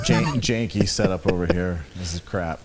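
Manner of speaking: low, fed-up monotone grumble; disappointed monotone. Pretend. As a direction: sound let down by everything. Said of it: "perpetually disappointed and speak in a monotone voice".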